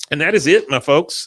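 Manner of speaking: ordinary talk, nothing marked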